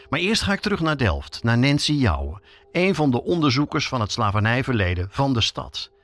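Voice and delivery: ordinary talk, nothing marked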